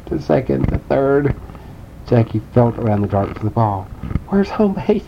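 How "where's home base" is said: high lost and confused; confused child voice. Acted. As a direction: ask blindly in the dark, high. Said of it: "he said".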